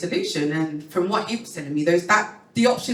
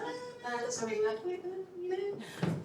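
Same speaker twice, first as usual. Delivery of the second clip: much higher, softer mimicked doctor; mock doctor voice; 13 dB quieter than usual. Doctor brushing her off, mimicked high and soft. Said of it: "He was like".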